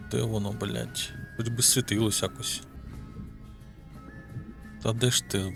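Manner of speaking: ordinary talk, nothing marked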